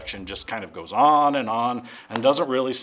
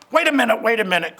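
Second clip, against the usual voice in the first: higher, louder urgent call; flustered narrator cry; 9 semitones higher, 6 dB louder, faster than usual. Acted. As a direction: stop them in a fluster. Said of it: "cried Jack the narrator".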